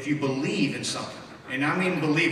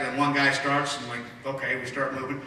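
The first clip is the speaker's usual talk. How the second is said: usual speaking voice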